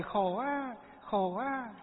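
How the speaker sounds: newborn crying. Retold or imitated. Monk imitating a newborn crying at birth, light mock wail. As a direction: whimper it like a newborn. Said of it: "khóc chào đời".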